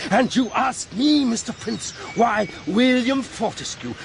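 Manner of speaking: heated rant, pressing each point; indignant tirade. Acted. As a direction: build in outrage at being questioned. Indignant tirade at Mister Prince.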